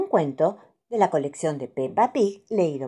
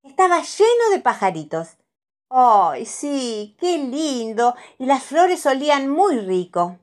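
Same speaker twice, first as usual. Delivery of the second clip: put-on high, bright little-girl delight; Pepa's voice; 6 semitones higher than usual. A narrator's reading. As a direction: gush like an excited little piglet. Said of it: "dice Pepa".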